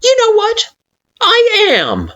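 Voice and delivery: high, loud burst; sudden outburst. Acted. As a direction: blurt it out all at once. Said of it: "in a sudden outburst".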